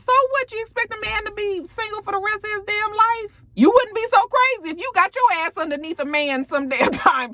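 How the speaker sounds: high-pitched, exasperated rant; incredulous scolding. Acted. Recapper scolds a TV character for judging, high incredulous rant. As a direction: scold her like she's being ridiculous.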